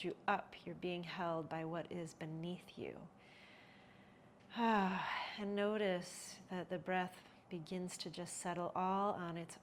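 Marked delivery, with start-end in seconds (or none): 4.5-5.3 audible settling exhale, falling away; relaxed sigh. Real, not acted. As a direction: let out a loud, relaxed sigh.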